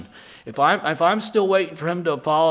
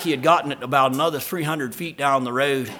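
normal talking voice

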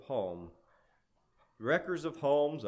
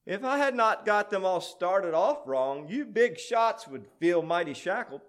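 higher, louder boasting imp; piping imp; 7 semitones higher, 6 dB louder than usual. A narrator's reading. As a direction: pipe up over the big shots. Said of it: "Up piped an imp".

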